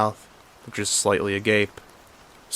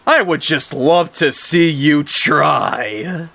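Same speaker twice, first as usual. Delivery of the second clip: higher, louder, very slow taunting villain; villain brother daring him to fight, slow and higher; mock taunt; 6 semitones higher, 6 dB louder, much slower than usual. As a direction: drawl the dare at him. Villain taunts before boss fight.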